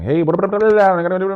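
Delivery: loud, high gabbling like an auctioneer; fast talk. Acted. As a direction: rattle it off in a mock auction patter. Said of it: "they do not speak fast".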